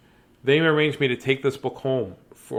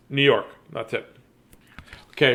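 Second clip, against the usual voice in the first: usual speaking voice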